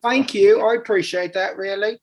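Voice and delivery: grateful in fake British; American faking a British accent; put-on English accent. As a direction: thank her in a mock English accent. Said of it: "that is not a good accent".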